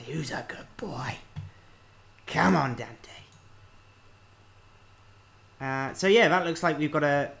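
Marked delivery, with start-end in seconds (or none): 0.0-3.1 whispered to the dog. Real, not acted. Coaxing his dog Dante.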